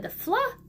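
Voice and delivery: fla popped high; sounding out blend. Shown as a demonstration. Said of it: "we know that blend".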